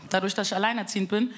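normal talking voice

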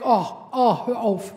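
a loud, put-on "oh, oh" of disgust; mock recoil. Asked about the colonoscopy, people recoil in mock disgust. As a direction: shove the question away, hammed up.

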